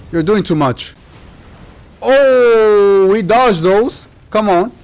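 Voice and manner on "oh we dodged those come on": excited yelling. Real, not acted. Frantic dodging in a fight.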